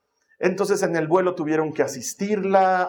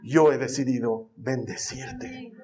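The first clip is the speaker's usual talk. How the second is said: low, very slow solemn pronouncement; God speaking a blessing to Joshua; 5 semitones lower, much slower than usual. Retold or imitated. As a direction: intone like a divine blessing. Voicing God's words.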